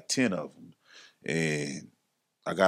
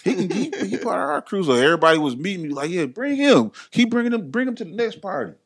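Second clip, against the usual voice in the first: much higher, enthusiastic crowd voice; eager welcome; 10 semitones higher, 4 dB louder than usual. Retold or imitated. The crew telling Johnny to keep bringing him, high and eager. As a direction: sound thrilled to have him around.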